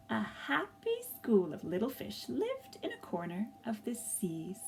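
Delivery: gentle storybook narration with lively swells; reading to children. A narrator's reading. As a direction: tell it like a picture book read to a class.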